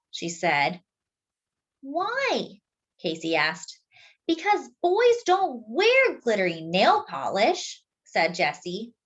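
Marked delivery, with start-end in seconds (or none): 1.8-2.6 small, high, soft question; innocent toddler. Acted. Toddler asks his sister why, high and small. 4.3-7.8 high, slow, matter-of-fact; know-it-all sister. Acted. Sister tells brother the rule, high and slow.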